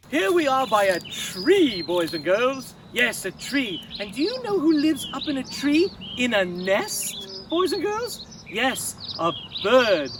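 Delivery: calling out loudly over the outdoors. Acted. Kids' show host calls out loudly to boys and girls.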